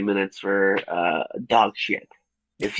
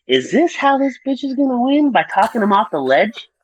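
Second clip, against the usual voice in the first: incredulous; 11 semitones higher than usual. Retold or imitated. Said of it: "thought crossed my mind".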